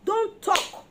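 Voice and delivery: high, loud warning; sharp loud command. Real, not acted. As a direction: bark the rule at them. Preacher stressing the charm's rule.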